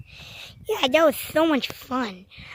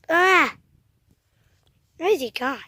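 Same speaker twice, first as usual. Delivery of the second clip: ordinary talk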